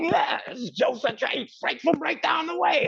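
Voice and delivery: high, bright impersonation of a record-store caller; high and lively; excited phone voice. Retold or imitated. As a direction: sound thrilled to be calling. Voicing Jocko on the phone.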